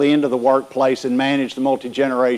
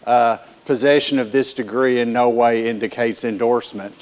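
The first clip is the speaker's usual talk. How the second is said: usual speaking voice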